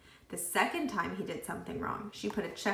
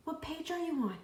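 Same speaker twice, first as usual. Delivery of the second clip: high, pushy demand; Joy demands Todd's page number, pushy; 8 semitones higher, 4 dB quieter, faster than usual. A narrator's reading. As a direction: insist on an answer. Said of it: "she demanded".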